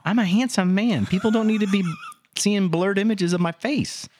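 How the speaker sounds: high, lightly pompous self-praise; mock vanity. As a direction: play the vain host jokingly. Mock vain complaint.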